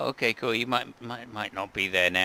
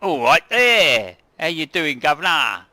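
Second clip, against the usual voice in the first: Cockney voice; 5 semitones higher, 11 dB louder than usual. Acted. -, in Cockney. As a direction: greet him like a Cockney geezer. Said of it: "the, the Cockney".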